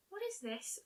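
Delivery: soft timid question. A narrator's reading. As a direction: ask it gently, almost afraid. Fluttershy asks softly.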